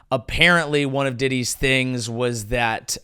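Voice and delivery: ordinary talk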